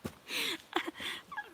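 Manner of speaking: very high, squeaky laugh; high-pitched giggle. Real, not acted. Amused while chatting live.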